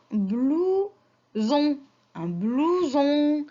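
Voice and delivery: "blouson" slowly split into "blou" and "son"; teacher sounding out "blouson" slowly for children; syllable by syllable. Shown as a demonstration. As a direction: stretch both syllables of "blouson". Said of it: "il manque la syllabe".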